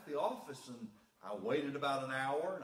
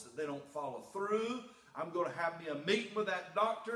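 louder retold tirade at the doctor; preacher hamming up his own anger; mock indignant rant; 6 semitones higher, 5 dB louder than usual. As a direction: replay the fleshly outburst, heated.

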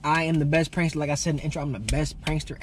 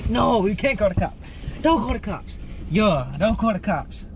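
alarmed protest; 7 semitones higher than usual. Real, not acted. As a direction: object urgently, pitch jumping up. Reacting to threat to call police.

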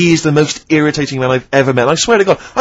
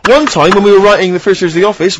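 normal talking voice

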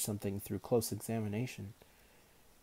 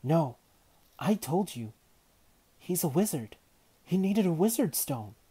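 desperate protest; 8 semitones higher, 8 dB louder than usual. A narrator's reading. Girl protesting loudly.

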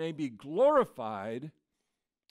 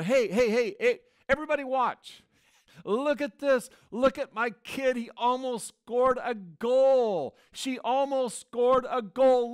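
higher, louder, hammed-up bragging; excited proud parent; 6 semitones higher, 5 dB louder than usual. Parent on social media boasting about a kid's near goal, excited. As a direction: show off your kid to everyone, stretching the big words.

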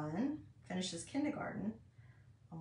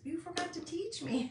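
put-on child's voice; 9 semitones higher than usual. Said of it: "And he goes".

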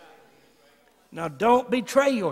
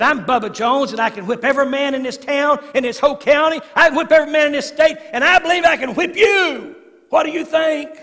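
higher, louder bully's boast, building; 8 semitones higher, 9 dB louder than usual. Retold or imitated. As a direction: swagger it up to a booming challenge. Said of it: "He said".

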